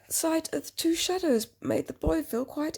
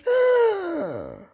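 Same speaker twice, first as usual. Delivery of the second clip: big, loud theatrical gasp; 6 semitones higher, 7 dB louder than usual. Acted. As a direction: gasp loudly in mock fright. Dramatic gasp at creature.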